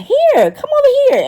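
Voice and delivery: put-on high sing-song call to the kids. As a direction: call them over sweetly, way up high. Calling kids over, high voice.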